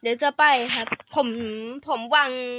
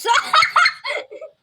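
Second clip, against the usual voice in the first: high burst of laughter; gleeful laugh; 13 semitones higher, 4 dB louder than usual. Real, not acted. Player sends friend back to start, laughing gleefully. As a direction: cackle at the friend's misfortune.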